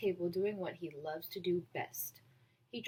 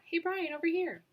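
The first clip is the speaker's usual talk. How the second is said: high, loud call across the room; 9 semitones higher, 5 dB louder than usual. Acted. Justin calls Brian over to his lunch table, calling out loud. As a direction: wave him over with your voice.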